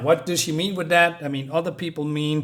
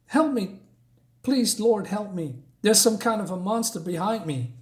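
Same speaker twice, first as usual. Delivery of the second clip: high, desperate plea for help; frightened plea; 6 semitones higher than usual. Acted. The terrified victim begs for help from a monster, high pleading voice. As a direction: beg the Lord for help, voice pitched up in fear.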